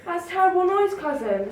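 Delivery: mildly alarmed mouse; startled outburst. Acted. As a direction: jump at the sudden roar. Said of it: "slammed the country mouse".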